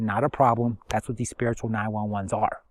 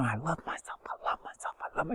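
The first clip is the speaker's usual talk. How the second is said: whispered affirmation on repeat; barely voiced, hushed repetition; silent inner voice; 14 dB quieter than usual. Shown as a demonstration. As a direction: whisper it as if only in your head. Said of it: "you say it in your head".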